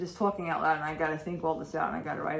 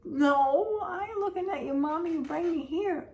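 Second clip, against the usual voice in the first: high sassy pet voice, much higher than her own; voicing the cat; 9 semitones higher, 4 dB louder than usual. Owner voicing her cat talking back to mommy, in a high sassy voice. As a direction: talk back like a cat giving mommy the side eye. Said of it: "She was giving me the side eye, like".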